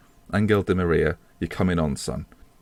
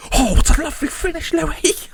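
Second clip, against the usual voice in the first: 1 excited cheer; 5 dB louder than usual. Real, not acted. Celebrating a goal.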